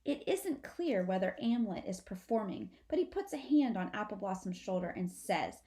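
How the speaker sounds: usual speaking voice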